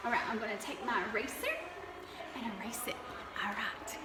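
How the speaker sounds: soft whispered aside; whispering. Real, not acted. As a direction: whisper it to yourself. Whispered aside while erasing.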